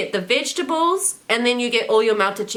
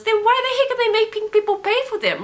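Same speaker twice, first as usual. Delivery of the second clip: indignant; 10 semitones higher than usual. Real, not acted. Ranting about paid loungers.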